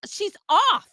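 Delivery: usual speaking voice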